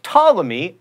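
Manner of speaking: ordinary talk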